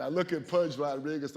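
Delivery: ordinary talk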